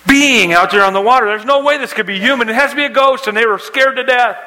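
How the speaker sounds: high, urgent, breathless-run storytelling; excited preacher telling of a storm at sea; preaching storm story. A narrator's reading. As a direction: drive the story upward in pitch as the panic builds.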